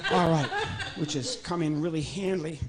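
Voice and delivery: ordinary talk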